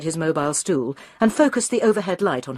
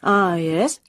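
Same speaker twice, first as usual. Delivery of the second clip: uninterested. A narrator's reading. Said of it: "without much interest".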